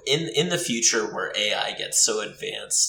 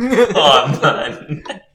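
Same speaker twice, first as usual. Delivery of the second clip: laughing slow echo; 5 semitones higher, 8 dB louder, much slower than usual. Real, not acted. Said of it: "Like Cyberpunk".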